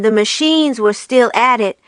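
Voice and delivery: ordinary delivery, nothing marked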